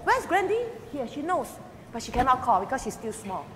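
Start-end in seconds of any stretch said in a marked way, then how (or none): 0.0-0.7 high sing-song asking; playful question. Retold or imitated. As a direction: quiz the baby gently. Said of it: "she can recognize".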